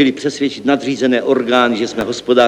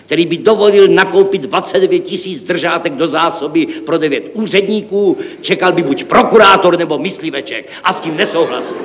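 higher-pitched, declaiming objection; indignant refusal; 6 semitones higher than usual. Acted. Director refuses to buy penholders, indignant.

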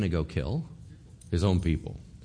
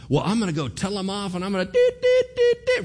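fast, high mock rant with pitched-up dit noises; mock angry rant; 10 semitones higher, 4 dB louder than usual. Fired-up believer brushing off the Spirit, mock rant. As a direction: play a hothead ready to tell them off.

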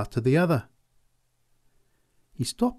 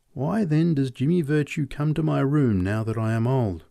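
usual speaking voice

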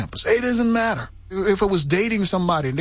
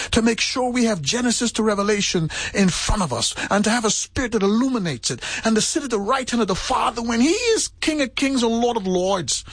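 rising, building sermon climax, pitch climbing higher; preaching at full force; 6 semitones higher than usual. Acted. Preacher to congregation about Christ's determination, building fervent preaching. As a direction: preach it, swelling with each line.

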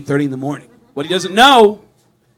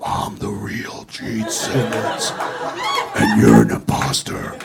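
very low, slow, shouted demon voice; much slower than usual. Pretend. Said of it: "walk in ... and go".

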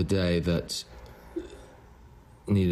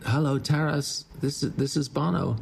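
raised-pitch phone greeting; mock phone call, pitched up; 8 semitones higher than usual. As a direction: act out a caller announcing himself. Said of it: "he's going".

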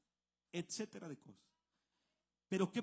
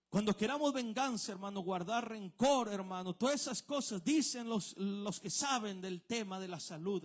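louder warning from the pulpit; forceful preaching; 4 semitones higher, 7 dB louder than usual. Acted. Pastor warning the brothers against revenge, loud preaching. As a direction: hammer the warning home.

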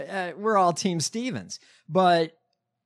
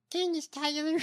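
much higher, put-on voice, softer; imitating her voice; 11 semitones higher, 5 dB quieter than usual. Co-host trying the name in the candidate's voice, mocking imitation. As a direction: mimic her odd voice on the name. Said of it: "With that voice?".

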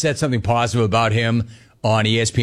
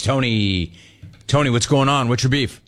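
ordinary talk, nothing marked

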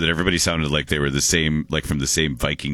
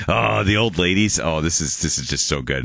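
usual speaking voice

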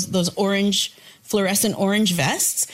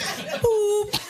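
imitating a truck beep; 11 semitones higher, slower than usual. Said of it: "they were like".

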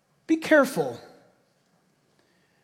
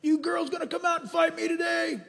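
a hint of a giant jeering, higher pitched; mock Goliath taunt; 7 semitones higher, 5 dB quieter than usual. Goliath yelling taunts at Israel's army. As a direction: taunt them like a bully. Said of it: "Goliath got up and yelled at Israel".

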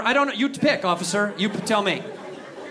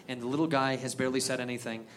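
ordinary talk

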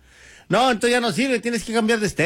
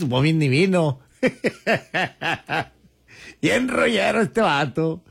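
ordinary talk, nothing marked

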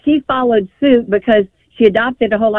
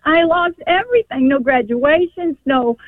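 grieving; 6 semitones higher than usual. Real, not acted. Grandmother to host on years lost with grandchildren, grieving. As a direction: let the loss crack through.

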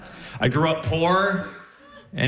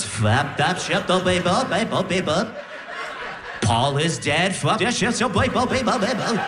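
backwards gibberish; 3 semitones higher than usual. Retold or imitated. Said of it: "Sounds like you're turning a record backwards".

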